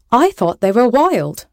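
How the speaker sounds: put-on young fellow. A narrator's reading. Young fellow answers angry pirate captain.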